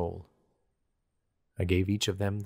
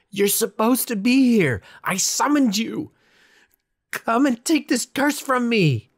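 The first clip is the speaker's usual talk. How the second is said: high, loud, slow desperate yell; screaming demand; 9 dB louder than usual. A narrator's reading. Begging the reapers to lift his curse, screaming. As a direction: demand they come, at full voice. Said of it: "I screamed".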